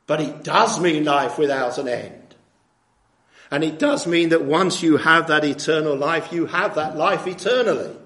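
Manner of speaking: insistent preaching. Real, not acted.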